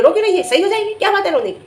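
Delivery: high-pitched mock telling-off; impatient scolding, pitched sharply up; imitated scolding. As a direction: mimic an elder scolding someone for crying. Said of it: "तो उसे तुम डांट के कह रही हो".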